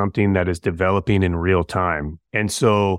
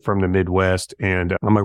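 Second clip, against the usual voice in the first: ordinary talk, nothing marked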